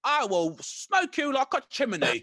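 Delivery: drawn-out shouted mock Cockney; bad Cockney. Mocking a film hooligan's threat, slow hammed-up Cockney. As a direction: ham up a Cockney hard-man threat, slowly. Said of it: "they get him to be the Cockney".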